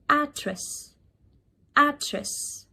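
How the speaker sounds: "address" as AD-ress, pitched high then falling; British pronunciation. Shown as a demonstration. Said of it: "British English that might be possibly pronouncing wrong".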